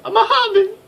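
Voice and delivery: mock a timid little creature; squeaky mousy voice. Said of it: "She looks like".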